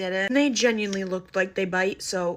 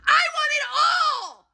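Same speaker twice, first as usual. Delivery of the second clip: very high, drawn-out tantrum shriek; imitated bratty yell, slow and high; loud demand; 4 dB louder, much slower than usual. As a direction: wail it like a spoiled child. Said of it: "everyone in Sephora could hear her".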